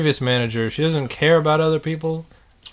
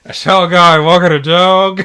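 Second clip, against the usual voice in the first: louder, higher put-on line; mock viewer voice; 5 semitones higher, 11 dB louder than usual. Imagined doctor viewer, hammed-up silly line.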